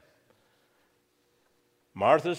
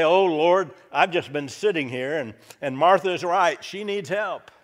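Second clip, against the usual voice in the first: high, loud, breathless apology; flustered mock gushing; 5 semitones higher, 8 dB louder than usual. Mary jumps up and gushes a high flustered apology to the Lord. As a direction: gush it like a caught-out sister.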